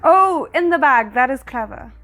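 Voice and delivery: usual speaking voice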